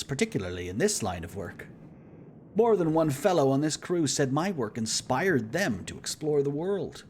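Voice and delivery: a hint of a jovial explorer; lightly voiced, cheerful celebrity; charming navigator voice. Kurt voice again.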